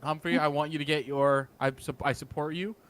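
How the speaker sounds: usual speaking voice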